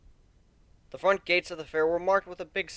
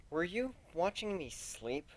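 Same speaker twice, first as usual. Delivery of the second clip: Kuno's soft voice; 5 semitones higher, 11 dB quieter, slower than usual. A narrator's reading. Kuno voiced softly in narration.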